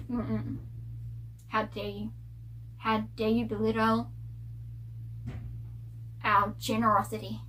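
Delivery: a scandalised hiss of disapproval; mock offended hush. Villagers offended at paying.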